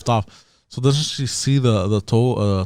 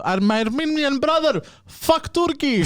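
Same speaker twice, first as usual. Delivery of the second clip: high, far-off mom voice; imitating mom; 10 semitones higher, slower than usual. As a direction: play his mother cheering on the Armenian. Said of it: "she goes".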